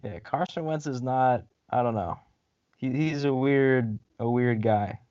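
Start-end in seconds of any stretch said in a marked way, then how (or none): none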